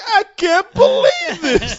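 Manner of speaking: high, slow, loud laughing moan; laughing disbelief. Retold or imitated. Looking out the car window, moaning in laughing disbelief. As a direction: drag it out in laughing shock.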